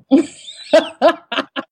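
long bright laugh; hearty laugh. Real, not acted. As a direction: burst out laughing. Laughs at own joke.

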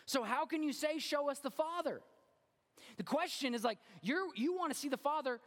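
high, driving pulpit delivery; high-pitched, forceful sermon voice; impassioned preaching. Acted. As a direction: preach it with rising urgency.

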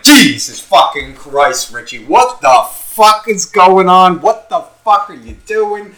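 high, loud, slow shocked friend; friends' shocked outburst. Retold or imitated. Friend shouting at Richie in disbelief, high and slow. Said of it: "They'll be like".

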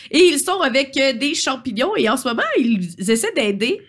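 normal talking voice

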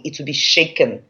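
normal talking voice

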